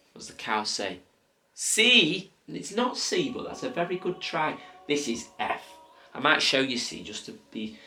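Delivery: gentle, sing-song coaxing voice; reading to a toddler. Acted. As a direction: coax a toddler kindly. Coaching toddler through letters.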